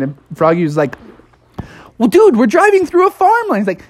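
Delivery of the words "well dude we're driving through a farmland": high-pitched cartoon disbelief; hammed-up worried frog; alarmed protest. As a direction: point out the problem in a high panic. Froggy alarmed at the detour.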